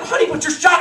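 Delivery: high, loud mock-frantic wife voice; distressed wife. Wife protests to wounded husband, mock-frantic.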